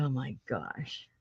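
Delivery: soft, slow, low groan of dismay; soft dismayed oh my gosh. Retold or imitated. Remembering losing her books, softly dismayed. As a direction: breathe it out quietly and slowly. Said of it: "so I go".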